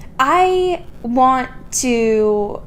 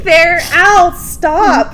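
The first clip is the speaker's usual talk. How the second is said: mock complaint; 7 semitones higher, 6 dB louder, much slower than usual. Joking about arm hair growing.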